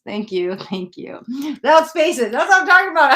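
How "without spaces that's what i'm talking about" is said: loud, high burst of amused recognition; excited exclamation. Real, not acted. Catches the hashtag mistake, amused.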